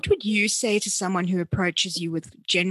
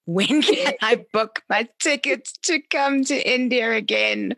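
raised, pleading voice, laughing mid-line; eager mock plea; 5 semitones higher, 3 dB louder than usual. Host longing to travel again, eager and laughing. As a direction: beg to book tickets now, with a laugh. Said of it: "But right now I'm going".

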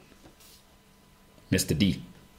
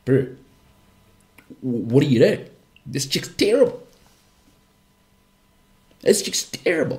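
high, exasperated bro calling it out; exasperated mate; 3 semitones higher than usual. Retold or imitated. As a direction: tell your mate straight, higher pitch. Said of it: "everyone's going".

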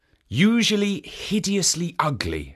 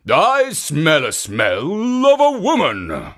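ogre voice; 4 semitones higher, 5 dB louder, slower than usual. Acted. Ogre sniffing.

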